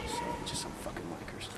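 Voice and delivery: quiet annoyed aside; muttered aside. Real, not acted. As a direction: mutter it off to the side. Aside about passersby.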